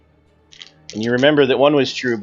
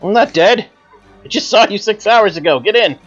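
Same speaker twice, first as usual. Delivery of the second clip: high, louder impatient reply; impatient driver; 10 semitones higher, 7 dB louder than usual. Acted. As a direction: brush it off and hurry him. GM voices Macintosh answering.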